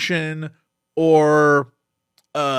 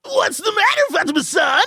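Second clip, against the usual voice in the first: very high whiny anime sidekick; 11 semitones higher than usual. Acted. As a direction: squeak it out like a panicky cartoon gremlin.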